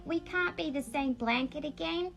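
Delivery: gentle storybook reading voice; reading to children. A narrator's reading. Reading a picture book to younger alters, gently. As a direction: read it like a bedtime book.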